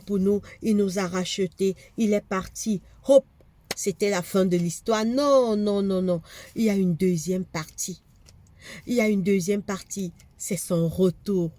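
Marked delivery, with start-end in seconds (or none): none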